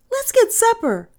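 put-on very high, loud little-girl excitement; eager cry. A narrator's reading. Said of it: "she cried".

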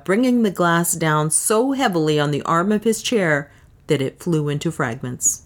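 ordinary delivery, nothing marked

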